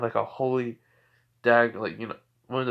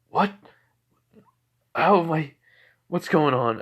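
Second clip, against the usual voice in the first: pitched up and louder, bewildered; confused surprise; 5 semitones higher, 6 dB louder than usual. Retold or imitated. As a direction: caught off guard, blurting it out. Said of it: "and you're like".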